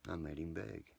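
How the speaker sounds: ordinary talk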